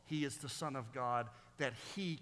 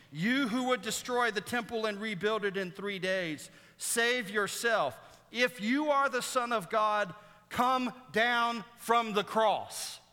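loud, higher-pitched jeering challenge; mocking taunt; 6 semitones higher, 4 dB louder than usual. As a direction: hurl it as a taunt, drawing out the commands. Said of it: "they both mock and taunt Jesus".

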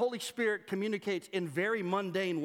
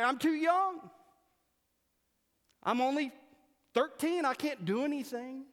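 mock teenager excuse; 6 semitones higher than usual. Teen excuse, mocked.

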